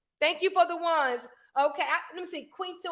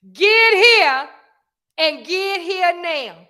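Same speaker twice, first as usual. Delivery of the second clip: forceful demand; 2 semitones higher, 7 dB louder, much slower than usual. Shown as a demonstration. Parent demanding the child's phone, forceful and loud. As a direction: belt out the order, then draw out the "now". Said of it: "When you go to talking like that".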